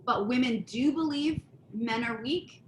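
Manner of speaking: ordinary talk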